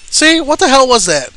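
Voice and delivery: loud, high outraged yelp; angry outburst. Real, not acted. Gamer yells at the game's mechanics, outraged. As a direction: burst out at the broken hit. Frustrated gamer yelling at game.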